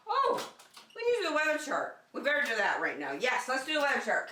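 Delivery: high "oh" of sudden remembering. Real, not acted. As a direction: catch yourself, forgot something.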